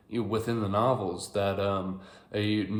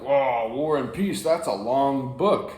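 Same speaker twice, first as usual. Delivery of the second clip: higher, louder imitation of an awestruck listener; impressed mock amazement; 4 semitones higher, 6 dB louder, slower than usual. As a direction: act out being wowed, drawing it out. Average person reacting to the book.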